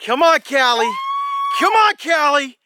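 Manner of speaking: high, urgent encouraging calls; excited cheering. Real, not acted. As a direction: urge her on, rising intensity. Urging fish to be reeled in.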